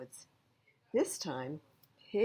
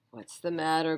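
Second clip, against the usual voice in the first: grumbling; 6 dB louder than usual. A narrator's reading. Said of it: "grumbled Pig".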